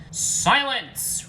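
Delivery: high, loud roar; commanding bellow. Acted. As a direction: silence the whole room. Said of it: "roared Garfield".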